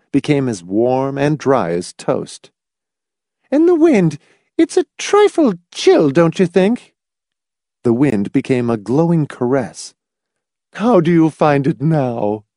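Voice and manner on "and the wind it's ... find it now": put-on very high, louder sweet old lady. A narrator's reading. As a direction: Mrs Pritchett asks about the wind, sweet and high. Old lady reshapes the wind.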